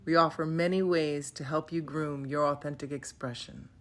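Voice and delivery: usual speaking voice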